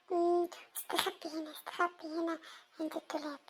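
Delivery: very soft, low, furtive murmur; hushed sneaking. Acted. Child hiding near sleeping uncle, hushed and furtive. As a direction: talk to yourself barely audibly.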